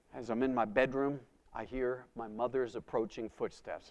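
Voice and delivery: normal talking voice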